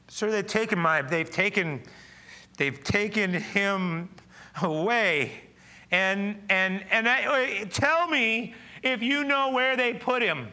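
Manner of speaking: high, loud, slow broken plea; Mary pleading. Acted. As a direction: plead through tears, words catching. Pastor voices Mary pleading.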